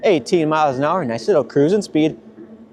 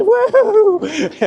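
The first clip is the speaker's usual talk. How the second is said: high joyful woohoo; excited whoop. Real, not acted. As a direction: whoop through the air. Whoop on another send.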